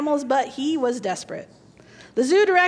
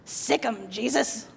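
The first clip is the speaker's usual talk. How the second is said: parrot cry; 4 semitones lower, 6 dB quieter, slower than usual. Acted. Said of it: "The parrot cried out".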